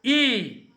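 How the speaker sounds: teacher demonstrating ई as a long ee; long ee demo. Shown as a demonstration. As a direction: say ई as a long, clear ee. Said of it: "बोलकर देखिए ई".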